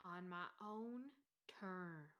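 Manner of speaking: low, very soft trailing repeat; quiet repeat. Acted. Sleeper repeating his own terms to himself, low and quiet.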